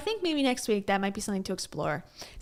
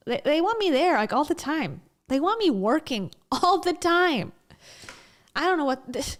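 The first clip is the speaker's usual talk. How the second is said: ordinary delivery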